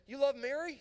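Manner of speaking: high, loud excited question; eager question. Retold or imitated. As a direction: fire it off, thrilled to find a fellow believer. Eager question to new roommate.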